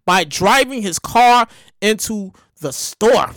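slow, measured news read with pauses; low, unhurried story read. Acted. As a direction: read the article out deliberately, dropping low at the end.

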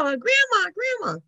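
high-pitched cry for grandma; child's yelling. Retold or imitated. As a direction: call out like an eager little girl. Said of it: "she was just yelling".

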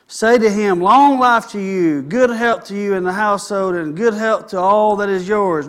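preacher reading David's message aloud to his flock; scripture reading. Acted. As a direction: read it out like a herald. Reads scripture aloud to congregation.

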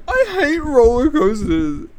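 high, slow, babyish whine mocking him; whiny mock baby voice. Mocking a contestant who whines about roller coasters, in a high baby whine. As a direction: whimper it like a big baby. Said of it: "and he's like".

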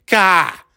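"Ka" for car, high open ah and no R. Shown as a demonstration. Mocking how New Englanders say C A R, "Ka" with no R. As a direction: punch out "Ka" with the R dropped. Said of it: "What does that sound like?".

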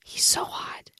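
breathy swooning whisper. Real, not acted. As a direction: sigh it out, dreamy. Swooning aside.

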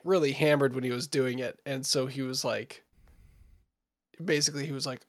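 normal talking voice

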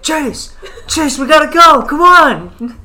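high, loud mock panic to a sleeping friend; panicked urgency. Waking his friend to flee the roommate, high panicked calling. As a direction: hurry him up like you're about to get caught.

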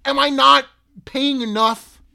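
loud, high mock indignation; sarcastic outrage. Real, not acted. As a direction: scoff at the price. Mocking the shipping price.

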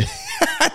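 airy squeaky laugh at himself; high breathless laugh. Real, not acted. Laughing at his own cracked voice, high and airless. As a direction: let a wheezy laugh escape.

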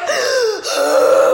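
loud, high gasp imitating a sob; big gasp, acting out a crying fit; mock sobbing gasp. Acts out her sobbing backstage.

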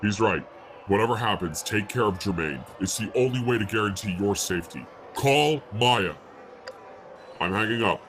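muffled voice through a phone line. Acted. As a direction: talk like you're on a phone call. First caller back on phone.

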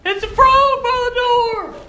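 very high, loud drawn-out shriek; piercing hammed-up wail; screaming sister. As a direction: scream it slowly in mock terror, imitating a frightened girl. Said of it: "she was screaming and crying".

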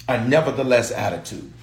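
ordinary delivery

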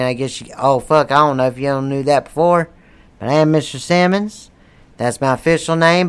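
normal talking voice

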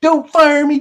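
high, loud whine on "Don't fire me"; whiny plea. Pretend. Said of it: "Can you imagine it?".

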